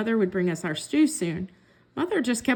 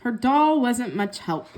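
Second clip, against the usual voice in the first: usual speaking voice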